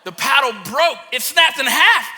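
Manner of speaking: astonished announcement, gaining force; excited reveal. Retold or imitated. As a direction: build from emphatic to high and loud. Repeats the reveal with rising excitement.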